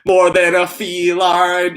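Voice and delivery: slow, laughing punchline; laughing repeat. Pretend. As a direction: crack up through the line. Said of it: "that's the way I had to deliver that".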